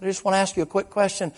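high, quick appeal; urgent retold request. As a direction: squeeze the question in before they get away. Preacher urgently stops the fleeing girl.

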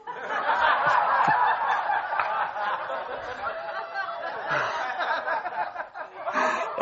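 long, high helpless laugh; real burst of laughter. Real, not acted. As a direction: crack up at the punchline. Punchline lands.

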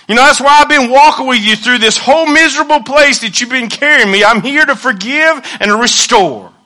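high, loud preacher's proclamation; full-force preaching. Acted. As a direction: preach it out with rising fervour.

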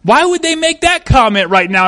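hammed-up suspicious wondering; suspicious inner voice. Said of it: "your brain starts going".

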